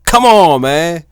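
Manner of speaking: high, loud exasperated come on. Real, not acted. Fed up with the debate, high loud exasperated scoff. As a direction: scoff at all the overthinking. Dismissing others' pocket/socket breakdowns.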